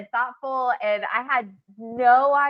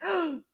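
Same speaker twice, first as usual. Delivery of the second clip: gasp; 8 semitones higher, 7 dB quieter than usual. Retold or imitated. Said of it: "I'm like".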